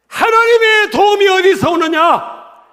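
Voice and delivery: high, loud, slow rhetorical cry; forceful preaching. Real, not acted. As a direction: thunder the question at the congregation. (크고 높게 외치는 설교조)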